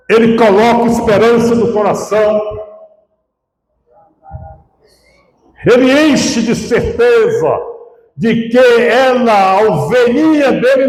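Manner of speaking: high-pitched, fervent pulpit delivery; impassioned preaching. Real, not acted. Pastor telling the congregation of the fold, impassioned preaching. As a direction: preach it out in long, ringing phrases.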